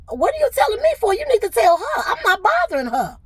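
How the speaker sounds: high, loud angry retort; indignant retort. Retold or imitated. Retelling her reply to HR on the call, angry and high. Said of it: "I said".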